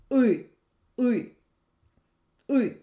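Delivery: "uh" as an exaggerated Russian ы, tongue pulled back; exaggerated hard vowel. Shown as a demonstration. Teacher exaggerates the hard way, drawn-back ы. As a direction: overdo "uh" as a hard ы, between "oo" and "ee". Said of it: "I will exaggerate and say it like".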